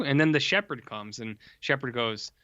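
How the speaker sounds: ordinary delivery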